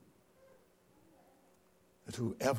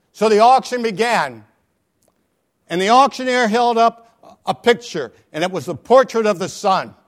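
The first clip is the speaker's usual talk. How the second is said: louder, rising sermon storytelling; full-force preaching; 6 semitones higher, 13 dB louder than usual. Real, not acted. Preacher telling the congregation a Christmas story, full force.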